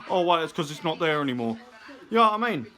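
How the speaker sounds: usual speaking voice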